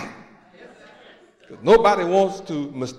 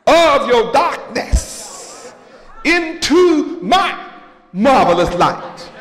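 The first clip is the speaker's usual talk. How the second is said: preaching; 6 semitones higher than usual. Acted. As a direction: build the congregation up to a shout. Preaching at full force.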